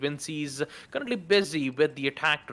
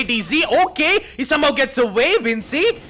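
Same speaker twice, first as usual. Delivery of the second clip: loud, high play-by-play building to shout; esports caster; 8 semitones higher, 7 dB louder than usual. Real, not acted. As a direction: call the clutch, rising to a shout. Live play-by-play, clutch round.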